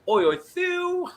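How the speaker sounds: high, slow cheery "Oi, oi" call; cheeky call. Pretend. Reading Disco's chat greeting as a cheeky call. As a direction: sing out the greeting like a mate across a pub.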